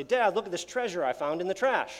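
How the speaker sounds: put-on a boy thrilled with his treasure; excited kid voice. Son excited about trash shirt.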